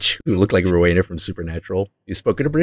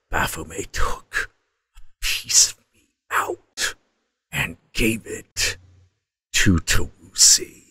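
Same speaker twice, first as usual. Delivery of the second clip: slow, hushed demonic whisper; 5 dB quieter, slower than usual. Retold or imitated. Demon explaining the missing piece, slow soft whisper. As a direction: whisper it slowly, phrase by phrase. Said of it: "And he's like".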